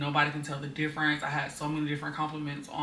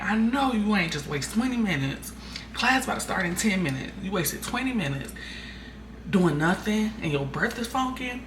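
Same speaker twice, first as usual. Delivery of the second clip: fed-up mom telling her off about the wasted time; scolding mom; 5 semitones higher than usual. Retold or imitated. Mom scolding her daughter for wasting time, exasperated. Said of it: "And I'm like".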